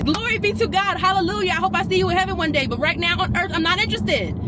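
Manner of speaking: high mock-holy brush-off; high sarcastic churchy send-off; sarcastic sweetness. Real, not acted. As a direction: bless him off with a laugh. Sarcastic dismissal.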